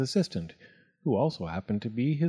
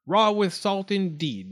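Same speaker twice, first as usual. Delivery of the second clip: slow, higher-pitched grumble; mildly scoffing, drawn out; muttered; 8 semitones higher, slower than usual. A narrator's reading. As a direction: mutter it with indignation. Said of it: "muttered Doctor DeSoto".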